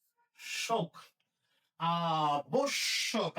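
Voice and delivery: আবশ্যক slowly as aa-bosh-shok; closed o vowel. Shown as a demonstration. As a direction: separate the syllables of আবশ্যক, rounding "bo". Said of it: "বয়ের উচ্চারণ ওয়ের মতো হবে".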